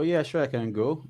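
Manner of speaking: ordinary talk, nothing marked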